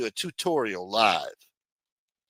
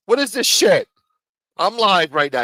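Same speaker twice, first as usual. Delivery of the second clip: higher, louder outburst of annoyance; irritated shout; 7 semitones higher, 7 dB louder than usual. Real, not acted. Streamer to a caller interrupting the live show, irritated shout. Phone call interrupts his livestream.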